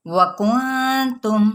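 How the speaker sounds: وکنتم very slow, nun hidden into ta; slow recitation. Shown as a demonstration. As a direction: recite وکنتم slowly with ikhfa. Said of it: "یہ نون احفا کرتے ساتھ ہی".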